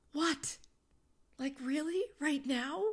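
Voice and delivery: high, loud incredulous what, rising; exasperated disbelief. Retold or imitated. Mother interrupted by her son mid-ritual, loud incredulous pitch.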